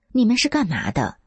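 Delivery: a touch of gruff confrontation in the reading; fierce questioning. A narrator's reading. Narrator confronting police at the doorway, mildly fierce. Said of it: "我凶巴巴地问他们".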